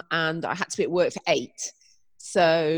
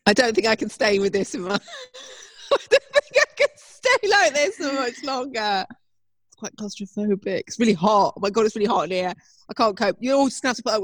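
laughing, muffled by a blanket; muffled under blanket; 4 semitones higher than usual. Real, not acted. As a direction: talk from under a blanket over your head. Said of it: "I've got a blanket on my head now".

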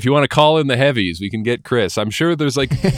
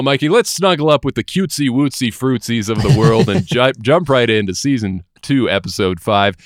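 ordinary talk

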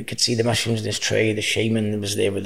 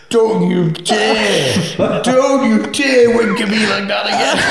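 high, loud, slow laughing rebuke; giggling don't-you-dare; laughing mock scold; 11 semitones higher, 7 dB louder, slower than usual. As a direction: tell him off while cracking up. [name] scolds the wink.

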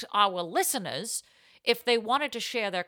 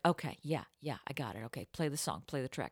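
quiet; 8 semitones lower, 10 dB quieter, faster than usual. Retold or imitated. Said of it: "And I'd be like".

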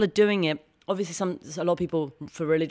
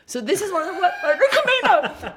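laughing at herself; 12 semitones higher, 4 dB louder than usual. Real, not acted. Laughing at own self-tape.

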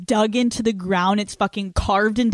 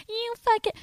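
imitating a shrill, much higher furious outburst; mock scream; 14 semitones higher than usual. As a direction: shriek it like a woman losing it at him. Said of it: "you're screaming and you're like".

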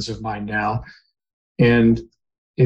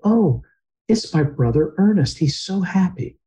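mother's awed quote; 7 semitones higher than usual. Retold or imitated. Mother sees dead brother.

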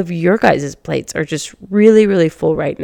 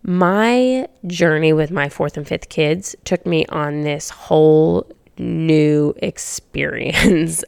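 ordinary delivery

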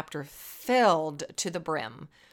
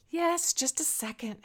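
sighed reply; 9 semitones higher, 3 dB quieter than usual. Acted. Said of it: "Hannah sighed".

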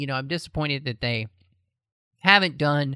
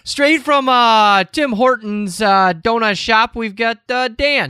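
put-on loud, pitched-up New York accent; 6 semitones higher, 10 dB louder, slower than usual. Host introducing Dan for the news, mock New York accent. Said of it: "That's my, uh, New York accent".

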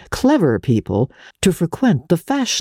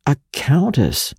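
slow, impressed drawl; a lightly voiced impressed journalist; whistled. A narrator's reading. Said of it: "whistled Ned Winsett".

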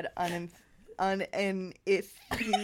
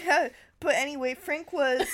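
higher, fast, tearful gush; blubbering; 6 semitones higher, 5 dB louder than usual. Acted. Anna pours her troubles out to the teacher, blubbering. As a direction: blurt it out through tears. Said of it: "she blubbered".

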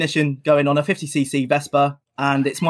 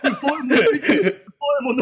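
high mock Churchill, laughing; Churchill quote; 11 semitones higher, faster than usual. Host teasing the Churchill slip with a high laughing mock. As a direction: parody the wartime speech, giggling. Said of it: "Winston Churchill".

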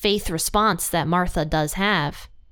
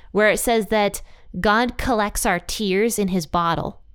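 ordinary talk, nothing marked

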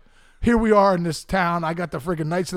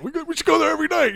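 put-on eager kid; 11 semitones higher, 4 dB louder, faster than usual. Kid begging for McDonald's.

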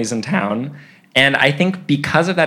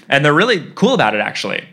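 usual speaking voice

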